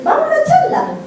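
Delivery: high laughing burst; laughing excitedly. Real, not acted. Excited laugh going to talk. (risa alta y emocionada)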